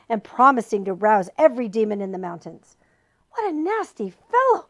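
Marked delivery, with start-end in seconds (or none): 3.3-4.7 gasped. A narrator's reading. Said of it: "gasped Milo".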